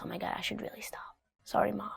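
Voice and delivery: put-on hushed, frightened child's apology; scared whisper. Child caught by mom, whispering a scared apology. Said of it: "they mean business".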